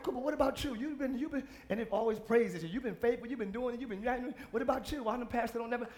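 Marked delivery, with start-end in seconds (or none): none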